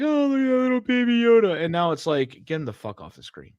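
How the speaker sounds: ordinary talk, nothing marked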